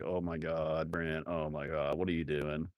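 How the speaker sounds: fed-up wife at the checkout; exasperated Big Boss. Retold or imitated. Big Boss waiting in line, low and fed up. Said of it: "So Big Boss was like".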